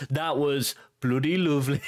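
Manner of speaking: jokey, exaggerated Brummie lilt; awful Brummie accent. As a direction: put on a bad Brummie accent. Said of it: "It's my awful Brummie accent".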